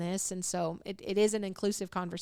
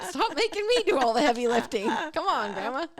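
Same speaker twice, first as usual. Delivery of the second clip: higher and louder through a laugh; 5 semitones higher, 4 dB louder than usual. Real, not acted. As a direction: laugh the words out. Laughing while speaking.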